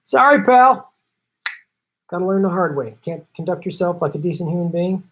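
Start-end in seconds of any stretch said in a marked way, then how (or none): 0.0-0.9 mock farewell. Mocking blocked commenter.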